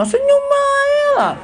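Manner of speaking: exaggerated high-pitched denial, then dropping; mimicked rebuffing girl. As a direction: play her brushing off the suitor. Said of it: "kata dia".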